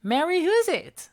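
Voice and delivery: put-on a child who never heard the word; little girl voice. A narrator's reading. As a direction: ask it like a confused kid. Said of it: "asked the girl".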